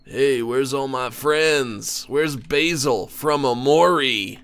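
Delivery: slow, stretched-out stoner talk. Pretend. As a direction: drag it out with a loose laugh. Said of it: "this steak got me high".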